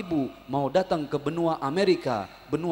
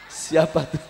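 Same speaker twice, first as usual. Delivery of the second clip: usual speaking voice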